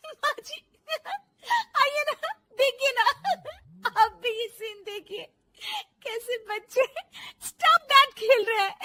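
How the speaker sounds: high, giggly voice breaking with laughter; laughing daughter-in-law. Acted. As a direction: talk through helpless giggles. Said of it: "बहू के जोर जोर से हंसने".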